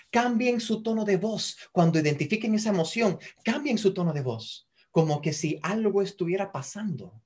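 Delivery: normal talking voice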